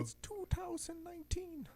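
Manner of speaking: very high, slow Irish squeak; Moira from Love Island. Acted. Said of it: "I can only do the Moira".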